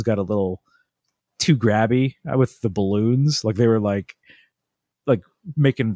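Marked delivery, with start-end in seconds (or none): none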